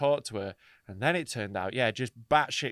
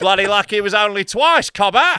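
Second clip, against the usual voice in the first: loud, higher Australian ocker voice; hammed-up Aussie bloke; joking in a loud Australian accent; mock Australian accent; 6 semitones higher, 10 dB louder than usual.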